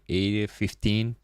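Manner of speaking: usual speaking voice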